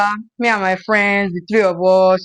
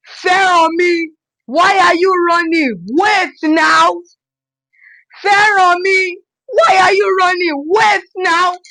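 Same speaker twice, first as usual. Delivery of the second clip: friends yelling after a runaway, building to a shriek; retold scream; 6 semitones higher, slower than usual. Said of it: "they screamed".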